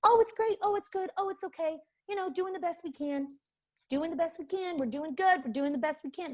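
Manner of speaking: high, breezy brush-off answers; bright chirpy everything's-fine voice; fake-cheerful teacher. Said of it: "you're gonna get these kinds of answers".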